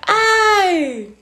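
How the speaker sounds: 1 sigh. Real, not acted. Sweating, overheated on live.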